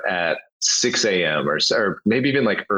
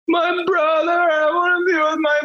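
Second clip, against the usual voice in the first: put-on much higher, wailing little boy voice; whiny crying kid. Passenger imitating the crying kid beside him, high and wailing. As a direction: play a five-year-old wailing for his brother.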